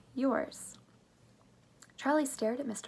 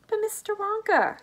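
much higher, startled objection; flustered protest; 14 semitones higher, 4 dB louder than usual. A narrator's reading. Grandpa Joe protests to Mister Wonka, flustered. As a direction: blurt it out high, taken aback. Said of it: "stammered Grandpa Joe".

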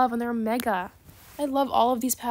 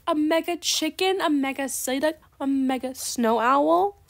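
higher, rising on each item; overwhelmed delight; 4 semitones higher, 3 dB louder than usual. Pretend. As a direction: list each prize in hammed-up awe, stretching the last. Listing dream pets, overcome.

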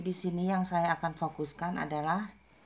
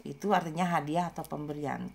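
ordinary talk, nothing marked

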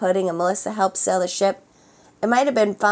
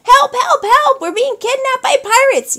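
much higher, loud cries for help; yelling for help; 9 dB louder than usual. A narrator's reading. Grandma and Oliver yelling while kidnapped, high and loud. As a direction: scream for help as you're carried off. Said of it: "yelled Grandma and Oliver".

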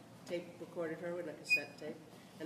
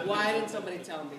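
usual speaking voice

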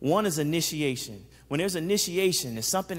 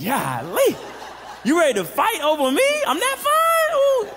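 high, loud, laughing hammed-up preen; mock-gush about being fought over; flattered delight; 12 semitones higher, 5 dB louder than usual. Flattered by a fight over him.

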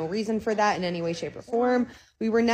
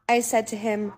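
ordinary delivery

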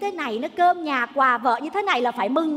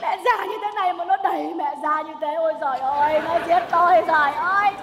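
melodramatic wailing. Acted. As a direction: wail to heaven as if attacked. Mother wails that she is being killed.

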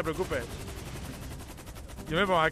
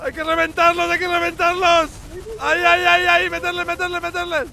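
hype shouting; 10 semitones higher, 6 dB louder than usual. Real, not acted. Hyping teammates during fight.

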